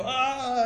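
high, drawn-out "aah"; an exaggerated showy wizard-like cry; crazy mock wail. Shown as a demonstration. Preacher mimicking what Jesus doesn't do, crazy high wail. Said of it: "He doesn't do some crazy".

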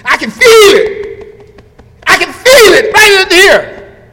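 high, loud and slow, hammed-up; mock lovesick claim. Preacher imitating the smitten man at the chapel, hammed-up and loud. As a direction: lay it on thick like a smitten fool.

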